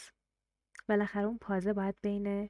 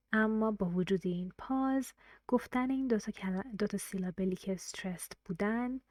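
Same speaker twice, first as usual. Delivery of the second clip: usual speaking voice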